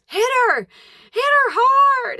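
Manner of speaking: high, loud acted command; urgent egging on. Friend pushes another toward the crying one, loud urging.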